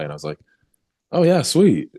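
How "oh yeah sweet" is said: high, loud burst of joy; retold delight at making the team; excited. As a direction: yelp it like you just got the call. Invited to play, thrilled.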